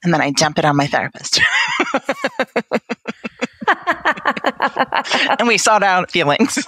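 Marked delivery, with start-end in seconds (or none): 1.5-5.3 long, high rippling laugh. Real, not acted. Guest joking about dumping it on her therapist, laughing. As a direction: burst into a run of high giggles.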